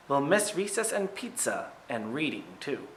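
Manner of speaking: usual speaking voice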